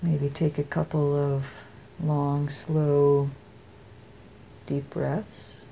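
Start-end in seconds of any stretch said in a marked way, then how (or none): none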